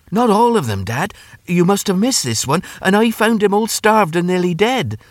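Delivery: high-pitched earnest son; boy pleading his case. Acted. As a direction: plead with dad in a high boy's voice.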